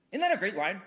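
usual speaking voice

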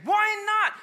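very high, loud whine; whiny kid. Retold or imitated. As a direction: protest like a sulky child. Kid whining back.

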